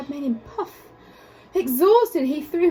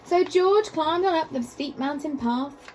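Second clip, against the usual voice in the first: usual speaking voice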